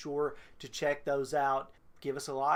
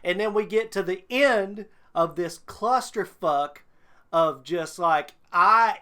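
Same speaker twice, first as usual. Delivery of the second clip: wrestling promo; 2 semitones higher, 4 dB louder than usual. Real, not acted. Calling out the fanfic author like a wrestler, loud. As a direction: cut a furious promo on the author. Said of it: "You gonna cut a promo?".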